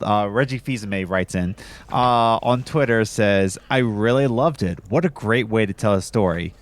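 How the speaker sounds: ordinary delivery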